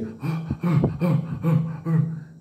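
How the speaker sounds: a sudden frightened gasp in; startled gasp; sharp gasp. Retold or imitated. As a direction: gasp as if something brushed the tent. Hearing noise at the tent.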